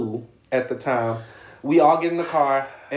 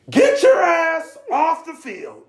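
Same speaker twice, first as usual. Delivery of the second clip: higher, louder, slow furious yell; red-faced coach yelling at player; 5 dB louder, slower than usual. Retold or imitated. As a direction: holler him off the field. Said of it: "head saying".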